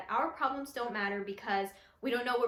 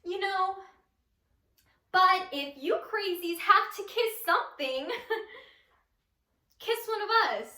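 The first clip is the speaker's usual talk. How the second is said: higher-pitched appeal, settling down by the end; unhinged monologue character; 7 semitones higher, 5 dB louder than usual. Acted. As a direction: plead with the so-called crazies, half laughing. Same character pleads with audience.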